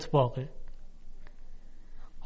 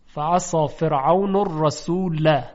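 Quranic recitation; 4 dB louder, slower than usual. Shown as a demonstration. Teacher recites verse.

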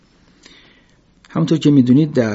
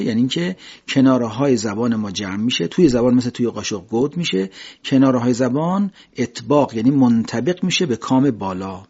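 ordinary talk, nothing marked

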